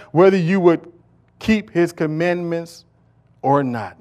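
loud, emphatic scripture reading; preached scripture reading. Acted. Reading Deuteronomy to the congregation, loud and emphatic. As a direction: declaim the verse over the congregation.

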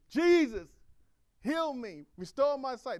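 crying out. Acted. Blind Bartimaeus crying out to Jesus.

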